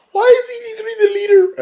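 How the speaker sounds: very high put-on whine; exaggerated high-pitched imitation; mock screechy complaint. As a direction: squeal it like an outraged wrestling diva. Imitates [name] complaining to [name].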